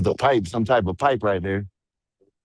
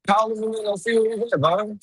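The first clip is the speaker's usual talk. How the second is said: high-pitched raised voice; shouting over wind; 9 semitones higher than usual. Real, not acted. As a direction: shout to be heard outdoors. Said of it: "Why are you yelling at me?".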